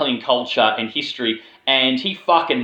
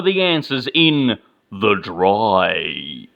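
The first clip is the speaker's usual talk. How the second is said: dramatic synopsis read. Acted. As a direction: read it like a suspenseful film teaser, stretching the final title. Reads a dramatic film synopsis.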